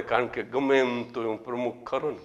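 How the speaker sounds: ordinary talk, nothing marked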